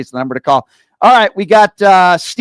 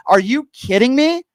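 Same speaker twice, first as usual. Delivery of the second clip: incredulous; 8 semitones higher, 3 dB louder than usual. Real, not acted. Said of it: "host outraged at Trump demand".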